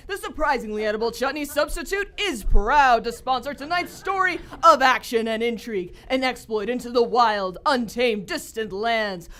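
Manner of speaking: bright, grand announcer read; radio announcer. Acted. Announcer introducing tonight's radio adventure to listeners, grand announcer read. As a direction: sell the sponsor and the show with flair.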